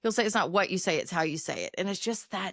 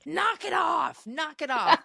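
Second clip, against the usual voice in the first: loud, slow yelled warning; dad's yell; 9 semitones higher, 4 dB louder, slower than usual. Retold or imitated. Dad yelling at giggling girls, loud mock shout. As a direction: bellow it like a fed-up dad. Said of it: "her dad would just scream this".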